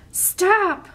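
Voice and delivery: sharp high shout to stop him. Acted. Fern shouting at the wolf to stop.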